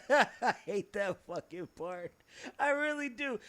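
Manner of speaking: laughing. Real, not acted. Laughing at the remembered line.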